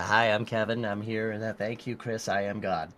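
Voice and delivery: low, soft mock-solemn producer voice; hushed fake Kevin voice; Kevin Michael Reed impression. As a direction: murmur it low like a godlike producer. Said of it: "Was that a Kevin Michael Reed impression?".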